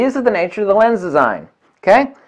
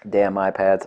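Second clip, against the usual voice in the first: ordinary talk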